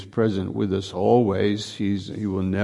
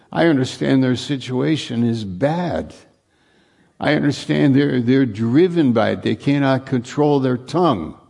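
higher and louder, driving the point home; preaching at full force; 3 semitones higher, 3 dB louder than usual. Acted. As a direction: preach it hard to the congregation.

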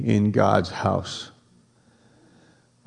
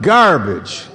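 "Garbage" said louder with a foreign twist; mock-foreign "Garbage", louder; accented garbage; 4 semitones higher, 6 dB louder than usual. Shown as a demonstration. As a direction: spit out "Garbage" with a put-on accent. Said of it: "Say it with an accent like garbage".

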